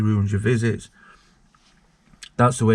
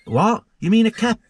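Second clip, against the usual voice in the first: incredulous; 10 semitones higher than usual. Retold or imitated. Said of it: "And I went".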